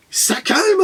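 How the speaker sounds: very high, squeaky exclamation; mock shock. Collector reacting to a steep price, mock-shocked squeak. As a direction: shoot up high in playful dismay.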